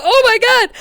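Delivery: high, loud crying-laugh squeal; squealing oh my god. Real, not acted. Streamer squealing as her friend's avatar rushes her.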